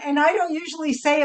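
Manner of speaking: usual speaking voice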